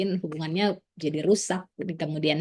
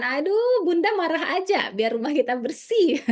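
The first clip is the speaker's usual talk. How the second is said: high, playful husband's teasing voice; 7 semitones higher than usual. Retold or imitated. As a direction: tease your wife while she does laundry. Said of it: "Nanti suaminya bilang ya kan".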